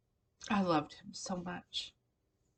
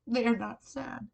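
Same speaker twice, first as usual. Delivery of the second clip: happy tears; 5 semitones higher, 5 dB louder than usual. Real, not acted. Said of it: "they are happy tears".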